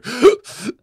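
sharp, high, loud in-breath; demonstrated gulp of air before tears; sudden crying gasp. Shown as a demonstration. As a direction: gasp as if punched, about to cry. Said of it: "and I go".

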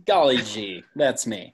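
put-on wholesome 1950s kid. Self-mocking reply to nerd teasing.

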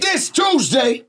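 high, drawn-out hype man shout. Shown as a demonstration. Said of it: "I'll be your hype man".

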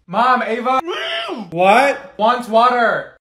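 loud yell across the house; calling out. Acted. Friend calling Mom about guest's water, loud holler.

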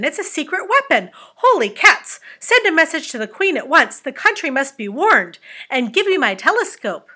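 high, loud alarmed captain; crying out. A narrator's reading. Captain ordering his crew to warn the Queen, crying out. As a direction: call out urgent orders at full voice. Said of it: "cried the captain".

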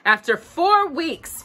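plain reading voice

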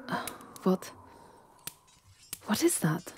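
hushed, alarmed whisper; startled whisper. Acted. Startled by sudden disturbance.

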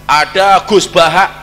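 usual speaking voice

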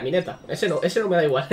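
ordinary delivery

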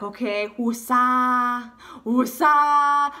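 long drawn-out calming mantra; slow, exaggerated woosah; self-calming. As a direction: stretch each word out like a forced meditation. Acting out calming herself down.

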